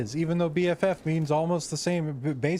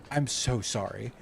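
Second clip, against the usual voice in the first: ordinary talk, nothing marked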